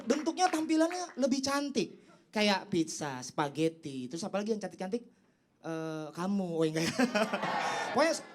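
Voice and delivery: usual speaking voice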